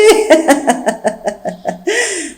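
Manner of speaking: a long helpless giggle; maid's giggle. Acted. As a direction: laugh in his face. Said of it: "Nicole n'arrête pas de rire".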